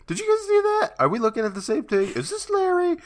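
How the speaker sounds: very high falsetto questions; squeaky mock bewilderment. Co-host voicing friends staring at fainted Larry, squeaky bewilderment. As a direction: squeak it out in joking disbelief.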